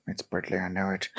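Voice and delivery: whispered aside. Real, not acted. Host guessing the director's name to the audience, whispered. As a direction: whisper it like a secret.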